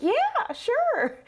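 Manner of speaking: lightly voiced eager boy; eager kid voice. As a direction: play him as a thrilled child volunteering. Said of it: "he's just like".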